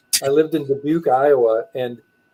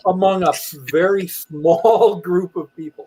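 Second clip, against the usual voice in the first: mock grandeur; 5 semitones higher than usual. Answering mom with mock grandeur.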